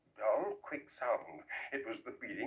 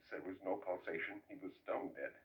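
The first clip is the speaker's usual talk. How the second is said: ordinary delivery, nothing marked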